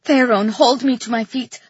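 ordinary delivery, nothing marked

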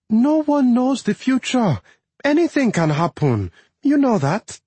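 lightly voiced anxious wife; wife's voice. A narrator's reading. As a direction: answer him carefully. Wife answering in argument.

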